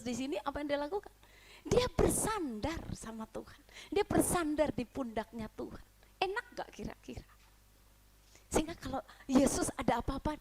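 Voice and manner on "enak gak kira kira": breathy aside to the congregation; whisper. Real, not acted. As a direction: lean in and whisper the question.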